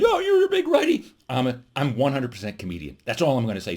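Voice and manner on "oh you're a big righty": high-pitched, hammed-up accusation; mock outraged emailer. Viewer from the other side accusing the comedian, mock outrage. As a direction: complain in a silly high voice. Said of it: "of you will say".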